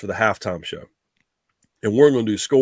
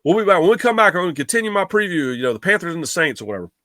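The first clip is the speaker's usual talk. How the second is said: radio announcer voice; 6 semitones higher, faster than usual. Retold or imitated. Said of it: "I go".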